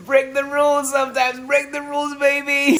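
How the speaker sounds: high, loud and cheeky; playful high exclamation. Real, not acted. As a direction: sing it out like a rebel.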